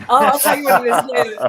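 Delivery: can barely hold in the laugh; real laughter spilling into the words. Real, not acted. Laughing while teasing a friend.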